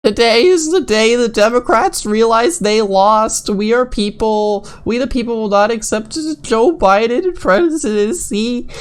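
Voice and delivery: mocking fanatic voice. Mocking quoted Trump supporter tweet.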